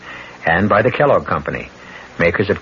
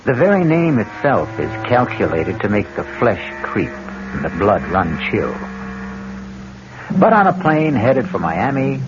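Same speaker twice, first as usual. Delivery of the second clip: a horror show host welcoming listeners into the dark; spooky host narration; 2 semitones lower, 4 dB quieter than usual. Acted. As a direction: savour every dread word like a horror host.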